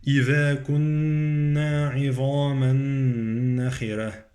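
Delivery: slow recitation, "عظاما" fused into long nasal n of "نخرة"; idgham. Shown as a demonstration. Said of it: "عندي إدغام عظاما".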